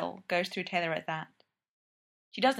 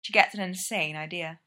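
plain reading voice

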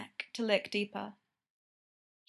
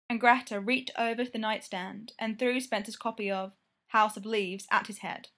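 plain reading voice

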